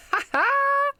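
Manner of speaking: a burst of high, loud laughter; high delighted laugh. Real, not acted. Player delighted at the meditating cat, laughing.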